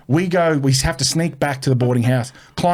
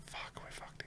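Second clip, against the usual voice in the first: barely audible retold whisper; hushed aside between culprits; whispered dread; 31 dB quieter than usual. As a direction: whisper it under your breath. Boys quietly panic before headmistress.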